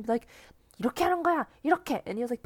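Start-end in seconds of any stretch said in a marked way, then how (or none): 0.8-2.0 mock coach yell. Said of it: "yell at him".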